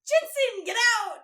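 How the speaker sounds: high, loud mock yell at him. Mother throwing her son out, mock yell. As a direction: shout him out of the room, hammed up. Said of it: "No wonder she's like".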